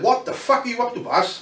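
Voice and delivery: high, indignant demand; high-pitched retold outrage; angry K-Man. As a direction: play the furious headliner confronting him. K-Man angrily confronting him backstage.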